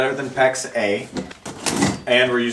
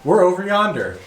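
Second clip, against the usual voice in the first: higher-pitched Southern twang; Southern accent; 6 semitones higher than usual. Shown as a demonstration. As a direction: model country Southern English for a learner. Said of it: "say some phrases in Southern".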